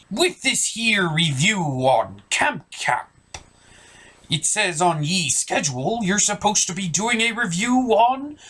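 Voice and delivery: slow pirate drawl, voice set apart from the host's; a sly old pirate teasing the host. Acted. Pirate character reads schedule.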